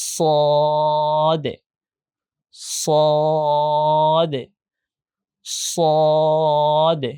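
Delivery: long, thick "স" with rounded o, then short "দে"; thick, heavy pronunciation. Shown as a demonstration. Teacher repeats for the class, thick letter demo. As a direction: draw "স" out heavily and close on "দে". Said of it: "আমি আবার বলছি".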